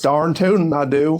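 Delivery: slow cartoon cowboy Southern accent. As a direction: play up a folksy Southern voice. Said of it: "they just go down to Southern".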